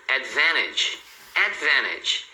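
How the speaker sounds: advantage with the T silent, as "advenedge"; dropped T. Shown as a demonstration. Teacher showing learners everyday speech, advantage without the T. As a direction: say advantage as if it had no T. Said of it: "como se esse T aqui não existisse".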